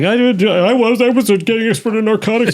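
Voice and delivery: high squeaky mock of the boss. Mocking the defensive boss in a high squeak. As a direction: mimic him, way up high and silly. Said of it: "And he's like".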